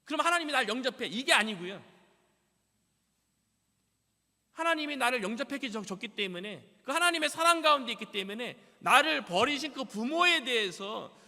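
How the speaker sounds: loud, high, impassioned sermon; full-force preaching. Acted. Preacher proclaiming scripture to congregation, loud and impassioned. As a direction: proclaim it to the whole church.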